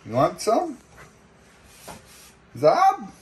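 high, rising coax; pet-talk offer. Real, not acted. Offering food to lemur.

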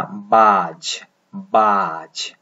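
बाज with a long aa, r dropped; model pronunciation. Shown as a demonstration. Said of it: "एक लंबा आ sound". (बाज, लंबा आ, र के बिना)

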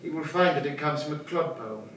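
ordinary delivery

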